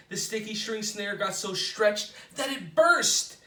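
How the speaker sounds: ordinary delivery, nothing marked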